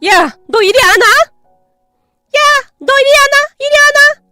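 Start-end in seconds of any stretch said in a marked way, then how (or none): 0.0-1.1 angry yelling aunt. Acted. Aunt yells at parrot.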